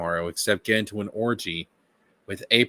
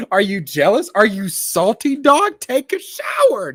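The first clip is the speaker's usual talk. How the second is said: mocking taunt; 10 semitones higher, 6 dB louder than usual. Real, not acted.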